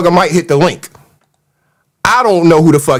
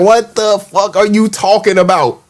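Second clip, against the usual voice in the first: ordinary talk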